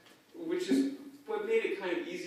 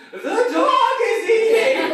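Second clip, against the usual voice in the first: outraged grandma; 18 dB louder, slower than usual. Retold or imitated. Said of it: "she goes".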